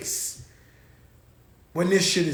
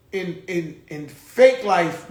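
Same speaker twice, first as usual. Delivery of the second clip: ordinary talk, nothing marked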